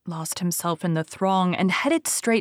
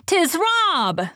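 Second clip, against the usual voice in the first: high, loud shout of recognition; the men calling out his name, much higher and louder; glad cry; 11 semitones higher, 4 dB louder than usual. A narrator's reading. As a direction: cry out in joyful surprise. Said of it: "they cried".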